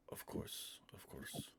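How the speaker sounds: soft whispered agreement; breathy servile whisper. Acted. Elder eagerly agrees in hushed tones.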